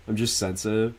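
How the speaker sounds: ordinary delivery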